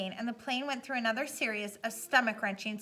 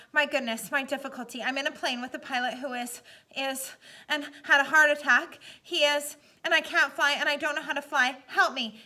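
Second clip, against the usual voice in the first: louder, higher, frightened rush of words; desperate plea; 3 semitones higher, 6 dB louder than usual. A narrator's reading. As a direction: beg for help, losing control. Brian pleads over radio.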